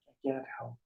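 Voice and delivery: quiet helpless admission. Acted. Seeker repeats his confession to himself, quiet and helpless. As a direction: let it sink to a quiet admission. Same protest repeated, dropping away.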